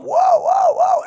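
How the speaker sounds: very high siren imitation; wailing siren. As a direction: wail like a house alarm. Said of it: "the siren goes".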